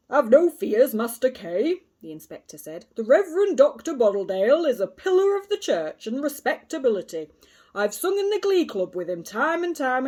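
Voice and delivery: loud, hearty, patronising policeman; a self-satisfied constable soothing a boy; pompous inspector. A narrator's reading. Inspector reassuring Kay with pompous bluster.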